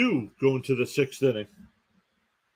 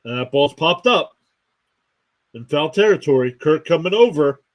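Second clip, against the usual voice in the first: louder, rising play-by-play call; excited call; 3 semitones higher, 6 dB louder than usual. Real, not acted. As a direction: call the catch as it happens, louder and louder. Foul pop-up, catcher falls making play.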